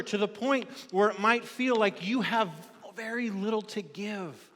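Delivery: fervent preaching. Acted. Pastor urging the church to give themselves, fervent. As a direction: press the call home to the congregation. Preacher builds to full force.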